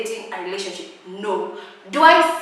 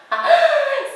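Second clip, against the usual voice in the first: sudden high loud laugh; bright burst of laughter; 6 dB louder than usual. Real, not acted. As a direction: break into laughter. Laughs at own joke.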